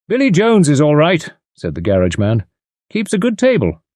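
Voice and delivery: a hint of the garage man.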